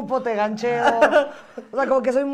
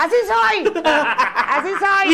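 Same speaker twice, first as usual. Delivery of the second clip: mock proud; 7 semitones higher, much slower than usual. Mock proud drunk self-quote.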